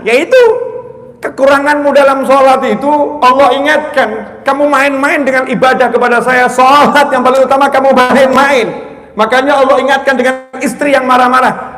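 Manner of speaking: loud, high-pitched preaching at full force; khotbah berapi-api. Real, not acted. (khotbah lantang dengan nada tinggi)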